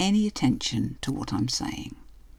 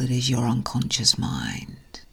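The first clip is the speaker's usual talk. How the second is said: right in your ear; 2 semitones lower than usual. Acted. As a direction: breathe it intimately into the ear. Hypnosis induction, whispered aside.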